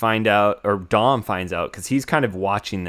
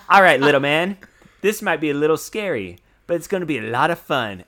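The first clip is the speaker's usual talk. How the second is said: a lightly mock British accent that starts louder and eases off; Statham to the baby; 4 semitones higher, 4 dB louder, faster than usual. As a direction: talk the baby through it, light British tough-guy voice. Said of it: "he goes".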